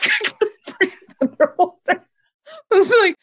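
high, laughing through the words; can't stop laughing at yourself. Real, not acted. Telling friends about scuba panic, laughing. Laughing at her own story.

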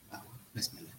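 whispered under the breath. Real, not acted. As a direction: breathe it like a quick prayer. Quiet prayer before starting.

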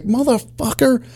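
high, drawn-out retold curse; mock angry outburst. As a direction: imitate a cop finally snapping. Quoting partner losing temper.

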